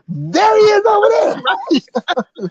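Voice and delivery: high, slow, drawn-out singsong; singsong pointing. Pretend. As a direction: stretch it high like spotting a celebrity. Joking about finding Steve.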